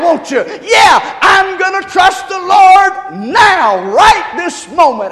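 high, loud shouted preaching; preaching at full force. Real, not acted. Pastor urging the congregation, shouting at full force. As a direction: drive the congregation to trust now.

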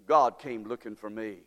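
normal talking voice

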